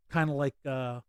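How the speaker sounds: ordinary talk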